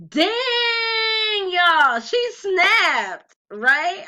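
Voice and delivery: high, loud dang to the viewers; excited exclaim. Real, not acted. Vlogger hyping mom's wisdom to viewers, high and loud. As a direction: shout it, impressed. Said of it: "reaction to mom's advice".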